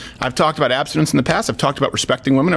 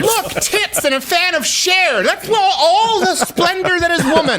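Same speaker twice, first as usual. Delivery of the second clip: high mocking voice; 13 semitones higher than usual.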